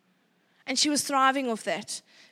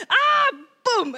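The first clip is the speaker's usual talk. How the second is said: sudden yelp of pain, higher and louder; pained yell; 9 semitones higher, 7 dB louder than usual. Retold or imitated. Mom hit by golf ball, yelping in pain. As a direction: yelp like a bone just got hit. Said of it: "And I was like".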